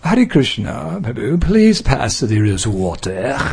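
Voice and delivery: slowed mock English accent. Teacher imitates English devotees asking for rose water in an English accent. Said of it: "Or like in England".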